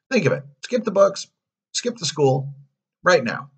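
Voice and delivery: high, punchy coaxing; eager coachman luring a boy; tempting salesman. A narrator's reading. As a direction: sell it hard in short bursts.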